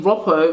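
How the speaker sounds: Roppo with a short o, rhyming with "hop-oh"; short, open first vowel in Roppo; correct name pronunciation. Shown as a demonstration. Vlogger giving viewers the correct way, short-o Roppo. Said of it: "which is the correct way".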